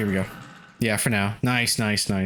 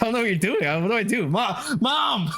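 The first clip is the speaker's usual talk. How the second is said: higher, panicky call for Mom; mock panic; 9 semitones higher than usual. Uncle retelling calling Mom for help, mock panicked and higher. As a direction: play the helpless uncle shouting for Mom. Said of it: "I was like".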